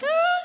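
high, loud "ah"; sudden loud cry, played for laughs; mock startled cry. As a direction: let out a high-pitched yelp, acted. Reacts to muscled inmates chanting.

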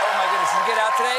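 loud thrilled exclamation; excited shout. Real, not acted. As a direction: shout it to the crowd. Host reacts to cheering crowd.

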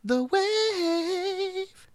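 singsong station tag; high mock jingle. Echoing the radio ident.

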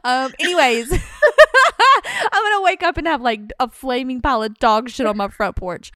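high, loud giggle through the words; real laughter breaking through; laughing nervously. Real, not acted. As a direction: laugh off the fear of revenge. Laughing at own fear.

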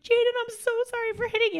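high-pitched child's apology, imitated; apologetic little girl. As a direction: play a small child saying sorry in a high voice. Voicing small daughter's apology.

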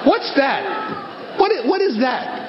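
high, baffled what's that; startled surprise. Real, not acted. Comic asks the audience about a noise, startled and baffled. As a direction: suddenly break off to ask about a noise.

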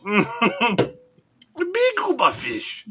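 very slow, high, goofy gungan drawl; Jar Jar voice. Pretend. Host echoes Jar Jar very slowly, high and goofy. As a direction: stretch every word out, silly and high. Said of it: "Jar Jar, man".

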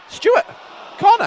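sudden high shouted call; excited shout. Real, not acted. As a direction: yell it out as the corner comes. Excited live commentary on corner.

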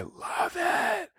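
over-the-top gushing; gushing outburst. Real, not acted. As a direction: squeal with delight.

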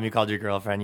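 ordinary talk, nothing marked

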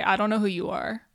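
usual speaking voice

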